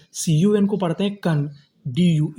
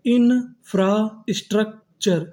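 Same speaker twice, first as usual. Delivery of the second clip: infrastructure split slowly as in, fra, struc, ture; very slow infrastructure, pausing between parts; syllable-by-syllable; 3 semitones higher, much slower than usual. Shown as a demonstration. Teacher building infrastructure for students, slow and split. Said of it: "तो हमारी meaning क्या बनी".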